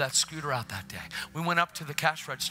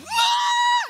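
imitated high-pitched loud wail; 10 dB louder than usual. Wife cries out, imitated.

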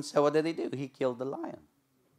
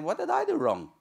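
innocent David; 8 semitones higher than usual. Acted. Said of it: "old David standing saying".

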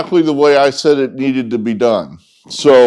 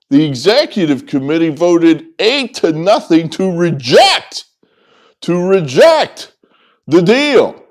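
raised pitch, hammering out the outrage; indignant emphasis; 4 semitones higher than usual. Real, not acted. Host decrying the committee's vote, indignant. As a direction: punch each phrase in disbelief.

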